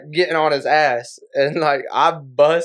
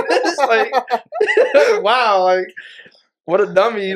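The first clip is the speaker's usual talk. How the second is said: higher, laugh-broken mock insult; laughing at him; 5 semitones higher than usual. Teasing the coworker who threw tongs, laughing. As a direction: giggle through calling him a dummy. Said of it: "So I start laughing more".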